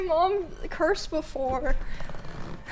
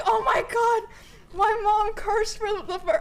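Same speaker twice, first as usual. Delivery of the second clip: high, drawn-out mock gasp of disbelief; shocked delight; 6 semitones higher than usual. Reacting to mom's first ever curse, shocked delight. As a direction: exclaim it high, as if scandalized. Retold shock at mom cursing.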